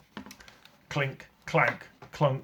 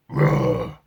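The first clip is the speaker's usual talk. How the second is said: low loud roar; lion roar; 10 semitones lower, 9 dB louder than usual. A narrator's reading. Lions leap about and roar at the raid, low loud roar. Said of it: "lions ... roared".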